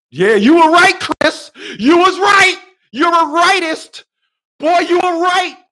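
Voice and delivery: high, loud mock praise; mock shout of praise. People a year later shouting that Chris was right. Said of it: "people be like".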